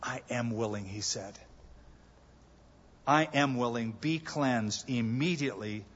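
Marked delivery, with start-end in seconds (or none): 0.0-4.9 soft, low and gentle; Jesus tenderly answering the kneeling leper; tender compassion. Retold or imitated. Soft compassionate reply. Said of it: "What compassion".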